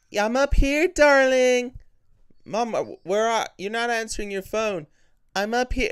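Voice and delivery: high, sweet imitation of a mother calling; eerie mimic of a loving mum; fake mum voice. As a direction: coax the kid upstairs, sing-song. Fake mother voice in film scene.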